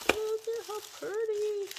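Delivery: high, loud delighted squeal; thrilled high squeal at a gift; excited gush. Real, not acted. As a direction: gush over how pretty it is. Unboxing gifts, gushing over item.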